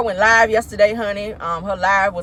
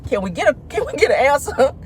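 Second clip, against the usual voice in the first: exasperated; 4 semitones higher than usual. Retold or imitated. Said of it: "I said".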